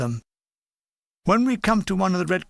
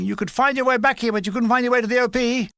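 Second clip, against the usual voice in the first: raised, higher-pitched reprimand; threatening shout; 6 semitones higher, faster than usual. A narrator's reading. Major berating a wounded soldier who came back, angry shout. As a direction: berate him like an angry officer. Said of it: "He's shouting at me and threatening me".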